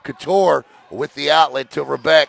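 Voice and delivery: usual speaking voice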